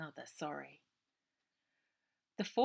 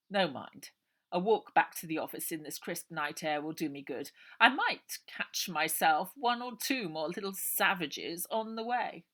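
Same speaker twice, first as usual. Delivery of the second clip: an oily man humouring his lady friend; smooth undertaker; 8 dB louder than usual. A narrator's reading. Uncle Arthur.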